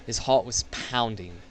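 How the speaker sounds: ordinary talk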